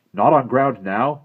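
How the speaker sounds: put-on loud, stern brave scolding the boy; a proud warrior unimpressed by fuss; disapproving Indian brave.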